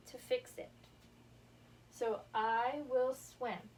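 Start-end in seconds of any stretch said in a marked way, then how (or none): 2.3-3.7 slow, deliberate read-aloud, each word spaced out. Shown as a demonstration. Teacher reads the board sentence to the class, slowly and clearly. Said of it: "So I'm going to use my red marker".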